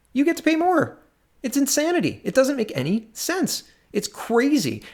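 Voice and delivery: high-pitched, incredulous exasperation; exasperated disbelief. Real, not acted. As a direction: pitch it way up, appalled at the absurdity. Exasperated repeat of an absurd claim.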